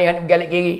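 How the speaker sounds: ordinary delivery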